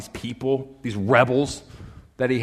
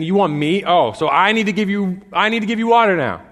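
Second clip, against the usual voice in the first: louder, higher sarcastic outburst; mocking angry Moses; 7 semitones higher, 8 dB louder than usual. Moses sneering at the complaining people, loud sarcasm. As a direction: spit it out at the rebels. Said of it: "he yells at them, calls them rebels".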